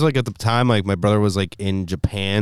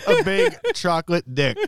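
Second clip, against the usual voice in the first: laughing, drawn out; 6 semitones higher than usual. Real, not acted. Joking guess repeated.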